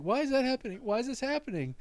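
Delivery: high, loud mock dismay; distressed kid. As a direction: imitate a child alarmed at his blood. Retold child alarmed by blood.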